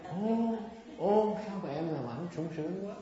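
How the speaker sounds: high, loud swooning "Ô"; hammed-up lovestruck exclamation; flattering suitor. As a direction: play a smooth wooer overcome with delight. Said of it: "ông tới ông cua cũng khéo lắm".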